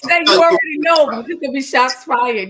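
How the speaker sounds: loud, fast, high hype; hyped up. Real, not acted. Guest hyping the host's show, loud and fast. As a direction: fire it off like a hype man.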